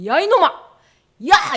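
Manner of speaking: loud, high yell of rebuke; sudden acted bellow; scolding shout. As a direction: yell at Satan as an angry God. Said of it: "이렇게 소리를 질렀어".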